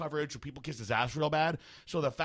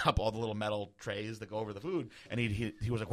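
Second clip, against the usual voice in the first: usual speaking voice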